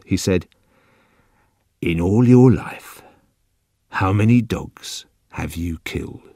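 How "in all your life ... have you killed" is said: put-on softly, as if to a child; quiet, very slow. A narrator's reading. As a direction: spell it out slowly and quietly for a child. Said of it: "quietly and very slowly, like someone to a child".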